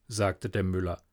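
ordinary delivery

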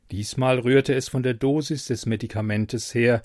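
ordinary delivery, nothing marked

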